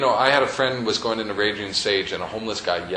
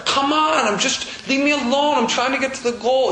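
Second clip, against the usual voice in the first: high, loud, whiny mock protest; whiny complaint; 12 semitones higher, 3 dB louder than usual. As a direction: play the fussing tackled player, pleading to be left alone. Voices tackled player's complaint.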